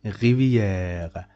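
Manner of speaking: rivière with the E held long, French R. Shown as a demonstration. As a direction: stretch the E of rivière. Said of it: "make the pronunciation of this E long".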